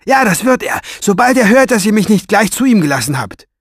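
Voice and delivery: high-pitched, heated yelling; defiant shout. A narrator's reading. Farid warns his captors of revenge, shouting. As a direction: cry out the threat, loud and high. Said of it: "schrie er".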